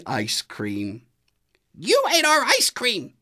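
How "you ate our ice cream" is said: very high, loud angry accusation; angry Goose. A narrator's reading. Goose confronting Donkey over the ice cream, angry. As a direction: scold him for eating it all. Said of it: "called Goose with an angry voice".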